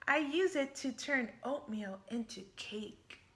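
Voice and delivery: usual speaking voice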